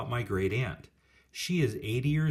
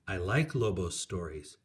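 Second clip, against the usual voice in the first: usual speaking voice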